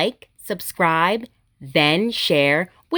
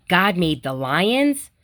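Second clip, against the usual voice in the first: put-on reading to children; 2 semitones lower than usual. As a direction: read it gently to little kids, each line a small wonder. Reading picture books aloud.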